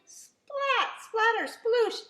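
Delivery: high, loud comic splat sounds; cartoon splat effects; splashy sound words. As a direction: play up the messy splash.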